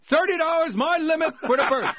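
high, loud hammed-up shout from the audience; mock yell. Father shouting his last bid across the theater, high and loud. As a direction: yell out the top bid, playful. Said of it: "he'd yell out another".

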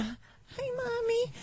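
put-on high, soft little boy voice; whiny son. Mom mimicking her son calling from school, high whiny child voice. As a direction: play the sick kid calling his mom.

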